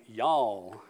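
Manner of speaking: "y'all" in a lightly drawn Kentucky accent; a hint of a Kentucky "y'all", low. Shown as a demonstration. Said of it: "not necessarily with that accent".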